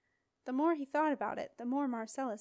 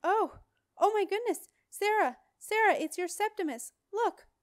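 much higher and louder, Sally spotting her son on the dragon; high, loud, breathless excitement; excited cry; 10 semitones higher, 8 dB louder than usual. Acted. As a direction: call out to Sarah in a rush of alarm and joy. Sally sees Septimus on dragon.

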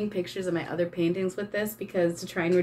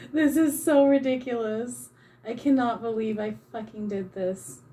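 usual speaking voice

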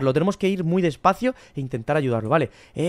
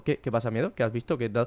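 low, soft worried question; quiet, careful checking-in; hushed concern; 9 semitones lower, 9 dB quieter than usual. Pretend. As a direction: ask a frightened friend gently, voice dropped low. Gently asking frightened Miedo what's wrong.

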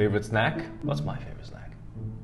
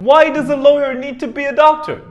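high, loud baffled questioning; incredulous rant; 10 semitones higher, 4 dB louder than usual. Real, not acted. Host ranting about a series title, loud incredulity. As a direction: fire off questions high and outraged.

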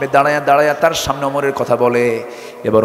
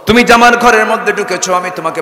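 louder, higher accusation; raised accusing voice; 7 semitones higher, 5 dB louder than usual. Acted. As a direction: throw the charge at him. Woman lists Umar's faults loudly.

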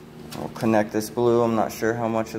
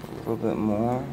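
straining to reach overhead; reaching up from a ladder, effort; 6 dB quieter than usual. Real, not acted. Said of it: "on a ladder to reach this".

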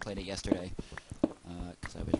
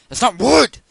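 sudden high, very loud shout; full-blast, high-pitched outburst; exasperated yell; 14 semitones higher, 20 dB louder than usual. Real, not acted. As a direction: explode at the game.

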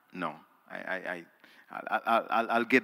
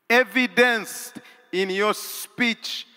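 high slow stressed words; slow emphasis; 12 semitones higher, 8 dB louder, slower than usual. Acted. Preacher stressing commitment to the congregation, slow and high. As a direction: hammer each word home.

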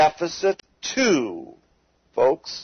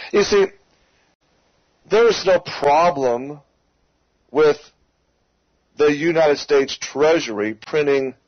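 ordinary talk, nothing marked

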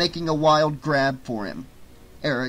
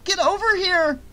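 much higher, loud call for help; yell; 4 dB louder than usual. A narrator's reading. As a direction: shout across the alley for him. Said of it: "Jess yelled".

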